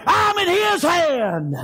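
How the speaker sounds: high, slow, drawn-out shout; triumphant preaching cry. Real, not acted. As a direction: cry it out high and let it fall.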